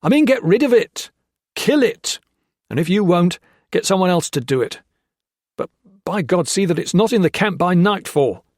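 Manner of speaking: loud, high commanding; officer furiously ordering Hawkins to kill the dog; furious orders. A narrator's reading. Gray ordering the dog killed.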